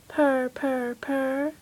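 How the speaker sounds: soft purring; kitten purr. Acted. Kittens enjoy their pie, soft purrs.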